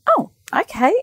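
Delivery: high, bemused little "oh okay"; taken aback. Retold or imitated. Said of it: "And then I went".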